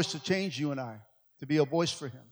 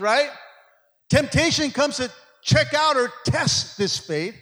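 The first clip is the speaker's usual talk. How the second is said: higher, driving sermon delivery; full-force preaching; 7 semitones higher, 5 dB louder than usual. Real, not acted. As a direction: preach it out to the church with punched key words. Preaches to the congregation.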